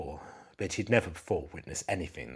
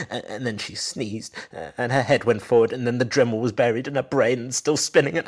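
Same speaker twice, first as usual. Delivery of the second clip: rising panic; 7 semitones higher, 7 dB louder than usual. Acted. Husband describing the fatal accident.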